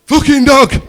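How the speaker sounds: high, loud imitated child's yell; top of his voice. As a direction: bellow it as the little son. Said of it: "Top of his voice".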